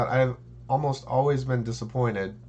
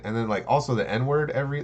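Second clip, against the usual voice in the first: normal talking voice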